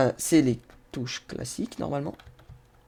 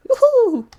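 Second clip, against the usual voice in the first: very high, loud "woo-hoo"; high whoop; 10 dB louder than usual. Real, not acted. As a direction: let out a piercing, drawn-out whoop. Cheers as the broken vehicle flails.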